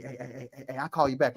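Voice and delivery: put-on hushed, panicky teen on the phone; hurried sign-off. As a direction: get off the line quietly.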